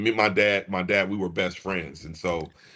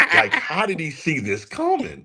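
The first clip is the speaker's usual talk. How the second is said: laughing amazement; 7 semitones higher, 4 dB louder than usual. Real, not acted. Amazed, laughing about dad.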